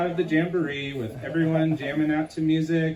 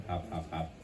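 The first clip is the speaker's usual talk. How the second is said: soft hopping sounds; 7 semitones lower, 10 dB quieter than usual. Acted. Narrator voicing hopping sound effect.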